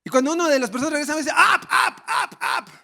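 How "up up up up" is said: imitating a sharp angry shout, higher; men in the mosque telling him to go up, angry. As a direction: bark it at the intruder, mock angry. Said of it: "pero enojados".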